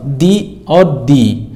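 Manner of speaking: "दी और दी" drawn out slowly with long "ee" vowels; slow careful "दी और दी" with long vowels; long dee. Shown as a demonstration. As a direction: stretch each "दी" out, very slowly. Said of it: "इस पे लंबा मतलब बड़ी की मात्रा है".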